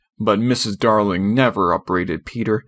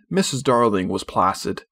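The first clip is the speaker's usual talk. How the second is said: plain reading voice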